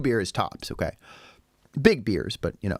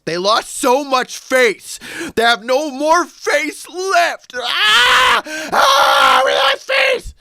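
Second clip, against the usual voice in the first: very high, loud, slow hammed-up wail; mock wailing shout; 10 dB louder, much slower than usual. Mocking the family who lost face, hammed-up shouting wail. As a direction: wail like the end of the world, mockingly.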